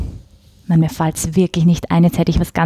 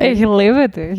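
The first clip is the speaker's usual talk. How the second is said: joking Meidling dialect; 2 semitones higher, slower than usual. Pretend. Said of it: "Das ist eine Meidlinger".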